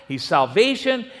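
normal talking voice

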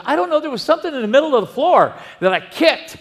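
high, fast, flustered explanation; flustered rush. Retold or imitated. Husband explaining to his wife at night, flustered and rushed. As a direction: blurt it out all in a rush.

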